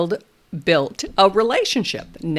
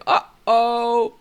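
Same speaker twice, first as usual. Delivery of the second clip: higher, louder mock uh-oh; playful uh-oh; 4 semitones higher, 4 dB louder than usual. Shown as a demonstration. Therapist reacting to the fallen hat, playful mock uh-oh. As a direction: shrug it off playfully. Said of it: "I say".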